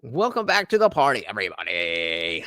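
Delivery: fast welcome, dropping low on "everybody"; hype host intro. Shown as a demonstration. Streamer greeting his chat, fast and punchy. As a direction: open the show like a party host. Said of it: "That's it".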